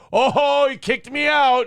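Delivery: loud, high mock complaint; mock whine. Podcaster mimicking someone who caused trouble then complains, in a loud high whine. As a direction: whine like a wounded innocent.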